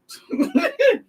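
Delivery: high, shrieking laugh. Real, not acted. Vlogger laughing at her own retort, high squeal.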